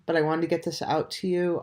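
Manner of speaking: ordinary delivery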